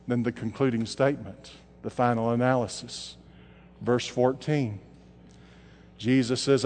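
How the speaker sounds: low, soft preaching with long pauses; soft closing appeal. Real, not acted. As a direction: bring it down for the invitation.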